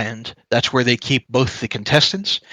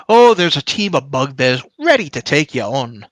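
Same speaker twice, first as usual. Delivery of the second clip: higher-pitched, projecting dwarf voice; dwarf announcer; 3 semitones higher, 3 dB louder than usual. Acted. Dwarf answers challengers from across arena, announcer voice. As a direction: announce terms to the whole arena. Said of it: "The dwarf to the north, as you shout this".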